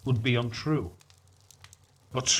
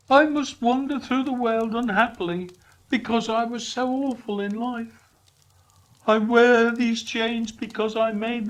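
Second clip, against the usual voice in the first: high, louder mournful spirit; Marley's ghost lamenting; 9 semitones higher, 4 dB louder than usual. Acted. Marley's ghost lamenting his chains to Scrooge, high and mournful. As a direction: wail out regret.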